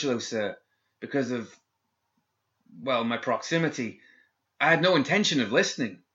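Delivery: put-on nervous young guard. A narrator's reading.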